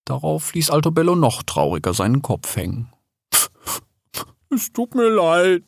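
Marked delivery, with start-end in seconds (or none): 4.5-5.6 high, slow, whimpering apology; tearful. A narrator's reading. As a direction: apologise on the verge of tears. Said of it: "fing an zu weinen". (weinerlich, hoch und langsam)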